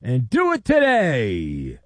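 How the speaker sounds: loud, punchy radio ad voice; host reading a sponsor spot with a loud, punchy delivery. Acted. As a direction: sell it hard to listeners.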